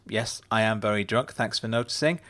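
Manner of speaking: usual speaking voice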